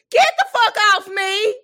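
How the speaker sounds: loud, high outraged yell; mock outrage. At the church lady slapping her thighs, loud mock fury. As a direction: shout her off like she's had enough.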